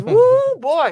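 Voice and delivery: high loud whoop; excited whoop. Real, not acted. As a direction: whoop about the game. Excited about the game.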